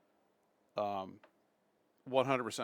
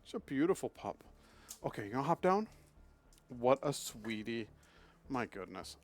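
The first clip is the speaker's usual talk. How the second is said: usual speaking voice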